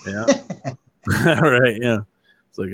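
ordinary delivery

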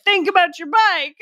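retelling the director's odd note, mock shouted. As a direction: toss it out like the director did. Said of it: "when he's like".

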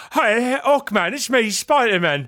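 slow, put-on British accent calling out; hammed-up British superhero greeting; Spider-Man. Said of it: "And then Spider-Man swings".

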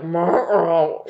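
drawn-out questioning animal noise; imitated dog whine. Comedian acting the dog looking at Sam, imitated whine. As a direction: voice a puzzled dog sound.

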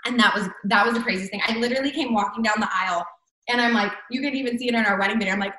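ordinary talk, nothing marked

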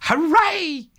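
high, ringing hooray; cry of joy. A narrator's reading. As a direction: crow it out in glee. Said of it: "the rat cried".

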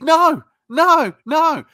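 loud, high repeated no; firm no. Retold or imitated. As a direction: cut him off loudly. Refusing the salesman's reasons.

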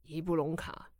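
a low, hushed call of the name; deep low voice. Acted. Stranger calling out Ibolyka's name in a deep low voice. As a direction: drop it low and quiet as the iron-shoed stranger calls. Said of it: "哦，好低哦".

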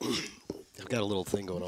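low, soft throat-trouble voice. Real, not acted. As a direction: drop the voice low and quiet. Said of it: "trying to lower your voice".

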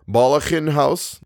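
slow, relishing delivery; savoring the words. A narrator's reading. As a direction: linger on the name with relish. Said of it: "savoring his words".

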